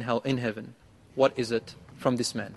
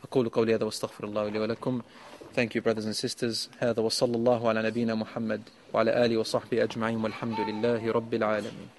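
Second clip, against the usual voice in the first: soft formal closing; 4 semitones lower, 7 dB quieter, much faster than usual. Real, not acted. Formulaic closing, soft and fast.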